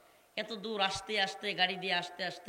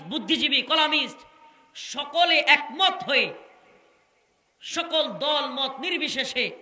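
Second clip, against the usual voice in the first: fiery preaching; 3 semitones higher, 7 dB louder than usual. Acted. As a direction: roar the list at the crowd.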